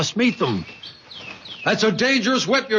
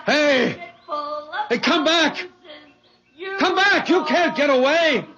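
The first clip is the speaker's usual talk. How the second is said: high, urgent shouts; calling out; 11 semitones higher than usual. Acted. George shouting after the fleeing girl. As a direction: yell after her.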